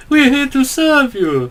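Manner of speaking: put-on fawning Italian waiter accent. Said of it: "that Italian guy going".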